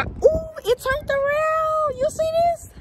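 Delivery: high, drawn-out squeal of delight; excited discovery. Real, not acted. Showing viewers it turns around, excited squeal.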